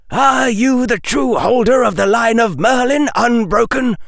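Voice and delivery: high, loud, imperious demand; elder witch's cry. A narrator's reading. Elder witch questioning Harry about the line of Merlin, high loud cry. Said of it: "cried the elder witch".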